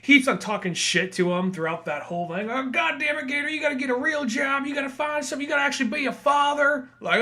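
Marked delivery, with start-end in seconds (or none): 2.5-6.9 put-on nagging old dad. Son mocking his old dad.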